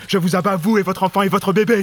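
threatening command. Acted. Threatening man holding gun.

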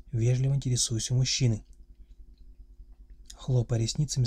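ordinary delivery

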